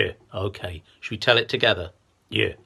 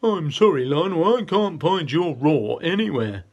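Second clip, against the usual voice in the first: hippo voice; 6 semitones higher, 5 dB louder than usual. Acted. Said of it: "came up and said".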